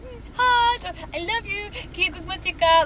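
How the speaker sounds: high, sing-song flirty hello; mock sweet greeting. Wishing she'd cooed back at Ken, mock sweet hello. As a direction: coo a playful hi back at a friend.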